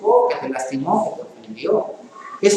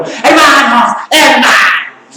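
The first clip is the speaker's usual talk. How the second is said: loud, high shout to the congregation; mock preacher shouting; 6 semitones higher, 7 dB louder, much slower than usual. Shown as a demonstration. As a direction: bellow it like a preacher without a mic. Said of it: "¿para qué grito?". (grito de predicador exagerado)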